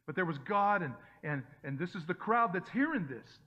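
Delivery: ordinary delivery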